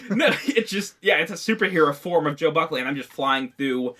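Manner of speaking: usual speaking voice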